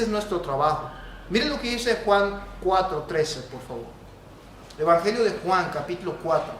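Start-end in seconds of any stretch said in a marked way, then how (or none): none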